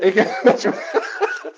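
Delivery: laughing hard while talking, high and loud. Real, not acted. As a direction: talk through a big laugh. Laughing reaction to a listener.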